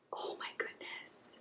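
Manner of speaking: whispered amazement; awed whisper. Real, not acted. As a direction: whisper it to yourself in wonder. Awed by swatched eyeshadows.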